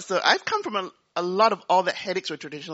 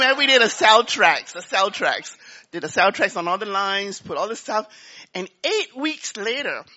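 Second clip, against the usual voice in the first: ordinary delivery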